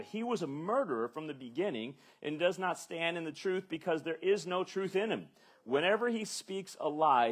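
loud, rising rebuke read with force; preaching at full force. Acted. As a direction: hammer home every line of the rebuke. Preacher reading Jesus's rebuke aloud.

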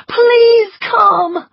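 high, loud tearful plea; crying plea. A narrator's reading. Scarlett begging the doctor, crying. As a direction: beg him through tears. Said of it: "she cried".

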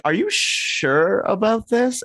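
a photographer eyeing a silly outfit; doubtful photographer. Retold or imitated. Photographer questioning Peter's feathered robe, in high slow disbelief. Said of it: "the photographer's like".

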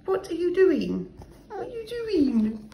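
high, soft cooing at a pet. Real, not acted. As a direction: coo sweetly to the dog.

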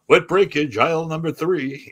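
a touch of a store PA announcer; deadpan cleanup call like a supermarket intercom; comic joking about a mess on stage; mock store announcement. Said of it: "I'm going".

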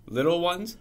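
plain reading voice

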